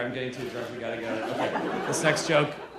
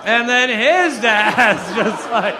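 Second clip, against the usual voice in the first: "his" stretched long with a swooping rise; drawn-out stress on HIS; 4 semitones higher, 5 dB louder, slower than usual. Real, not acted. Comic going back another generation of dads, "his" loudly stretched. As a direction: lean hard and loud on "his", dragging it out. Running bit, escalating lead-in.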